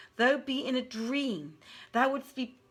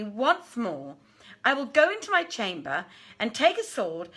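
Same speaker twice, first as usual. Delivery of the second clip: loud and high, furious threat; angry outcry; 4 semitones higher, 6 dB louder than usual. A narrator's reading. Princess angrily refusing marriage to her father, loud furious cry. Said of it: "turned upon him angrily and cried".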